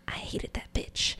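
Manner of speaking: slow, hushed whisper. Real, not acted. Confessing dislike of second metamor to the group, whispered.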